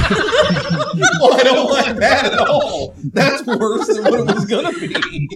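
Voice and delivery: high breathless laughter through words; laughing too hard to talk. Real, not acted. Can't stop laughing.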